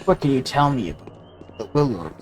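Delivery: lightly done tough spy voice; a hint of a codec soldier call; Snake impression. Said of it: "Well, Snake".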